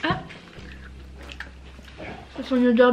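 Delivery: chewing while talking; talking between bites; tags: mouth full of waffle. Real, not acted.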